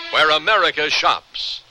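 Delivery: ordinary talk, nothing marked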